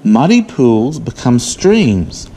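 ordinary talk, nothing marked

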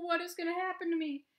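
high, fast fretting; worried Mister Bingle. Acted. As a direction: gabble in panic. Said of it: "said Mister Bingle".

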